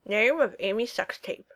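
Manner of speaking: talking around fingers in his mouth; muffled by fingers in the mouth. Acted. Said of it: "said around the fingers he'd shoved in his mouth".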